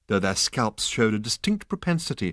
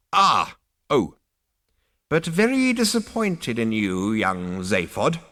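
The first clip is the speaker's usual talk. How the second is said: put-on disappointed old ghost; 3 dB louder than usual. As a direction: chide like an ancestor.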